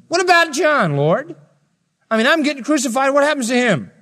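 high, whiny protesting Peter; voicing Peter. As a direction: point at John, indignant. Peter complaining about John.

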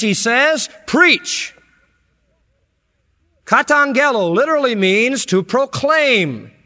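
loud, high, impassioned preaching; soaring revival preacher cry; preaching at full force. Acted. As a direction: build it up like a pulpit climax.

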